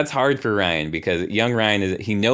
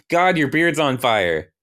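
higher, urgent warning; acted alarm; 7 semitones higher, 4 dB louder than usual. Young [name] warns God his beard burns, mock alarm. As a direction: blurt it high, in mock alarm.

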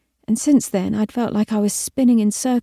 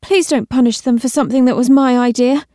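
higher, louder urgent plea; pleading; 4 semitones higher, 6 dB louder than usual. A narrator's reading. Trainee begs the Queen to spare friends, pleading. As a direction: beg on behalf of others. Narrator pleads against the punishment.

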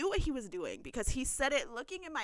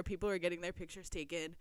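normal talking voice

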